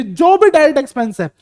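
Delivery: ordinary talk, nothing marked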